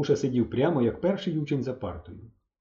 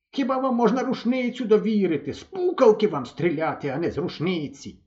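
put-on higher, scolding old man; angry scolding; 8 semitones higher than usual. A narrator's reading. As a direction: scold him like a schoolboy. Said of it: "дід розсердився на нього". (дід сердито лає мисливця)

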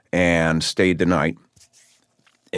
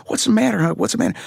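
imitating worried wife; much faster than usual. Man retelling his wife rushing to his bed, high and worried. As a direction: ask it the way his panicked wife did. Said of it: "My wife, Lisa, comes over".

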